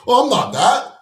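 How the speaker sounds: high, loud mock protest; exaggerated, high-pitched imitation of a denial. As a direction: mimic someone disowning it in a high, loud voice. Acting out someone disowning the group.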